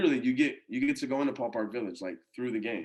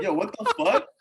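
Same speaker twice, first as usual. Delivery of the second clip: retold startled reaction, high and loud; startled protest; 8 semitones higher, 5 dB louder than usual. Imitating the ballplayer caught by a camera flash, startled protest.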